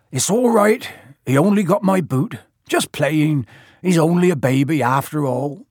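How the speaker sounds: high voice recovering from pain; strained, indulgent excuse for a naughty pet; Hagrid making light. A narrator's reading. Hagrid excusing the baby dragon, pained but fond.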